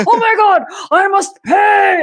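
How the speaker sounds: high, loud, slow shout; high dramatic shout. Retold or imitated. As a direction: cry it out high and loud, dragging it. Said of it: "she was like".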